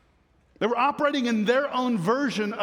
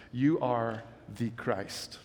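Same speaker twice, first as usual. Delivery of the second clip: hushed confession; 9 semitones lower, 6 dB quieter, slower than usual. Acted. Peter's confession, voiced solemnly.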